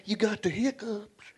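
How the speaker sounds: a hint of a sly dad asking; lightly voiced scheming father; dad plays innocent, faintly acted; dad's voice. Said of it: "He go".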